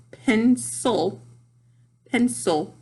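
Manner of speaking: "pencil" slowly as pen, pause, sul; split into syllables. Shown as a demonstration. Teacher making syllables audible, "pencil" split in two. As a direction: draw "pencil" apart into two clear beats. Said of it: "I can hear it if I say it".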